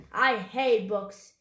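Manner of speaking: loud, gruff orders to the crew; loud, scornful pirate bluster; blustering pirate captain. A narrator's reading. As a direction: bark orders at your men.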